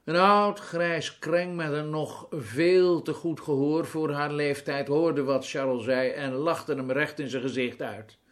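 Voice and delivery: usual speaking voice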